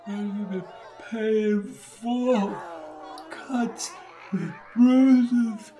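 high, slow, broken delirious mumbling; vague dying mumble. A narrator's reading. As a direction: let out halting fragments as life slips away. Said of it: "He began to mumble again in vague, almost indiscernible words".

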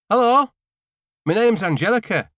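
high, loud, sing-song greeting; bubbly hello. Acted. New neighbour Angelica greeting Gordon, high and loud. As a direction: bounce in with a bright welcome.

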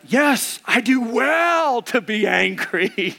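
hammed-up indignant insistence; defiant Jonah. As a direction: stretch it out like a petulant protest. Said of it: "So that's where Jonah's at".

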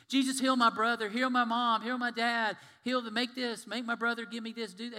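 loud, high pleading voices; clamoring crowd. Retold or imitated. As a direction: beg loudly like a pushy crowd. Crowds begging Jesus for healing.

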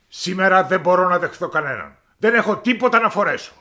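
high, loud royal tantrum; a spoiled king shouting his complaint; petulant shouting king; angry king. Acted. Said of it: "του είπε θυμωμένα".

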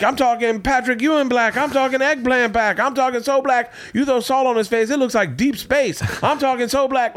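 high-pitched comic voice doing a joke; squeaky, hammed-up standup voice; Ralphie May bit. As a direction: rattle off the jokes in a high comic voice. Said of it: "he would just hammer you".

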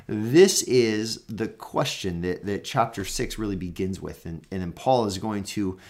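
ordinary talk

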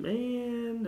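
long, high-pitched drawn-out word; emphatic exclamation. Real, not acted. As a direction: stretch it high in remembered misery. Recalling a miserable icy drive.

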